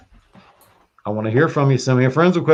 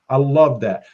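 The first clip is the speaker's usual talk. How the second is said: normal talking voice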